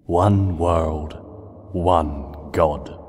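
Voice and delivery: low, cold declaration; cold monster voice. Acted. Nightmare Moon declaring her rule, low and cold. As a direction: pronounce it like a decree.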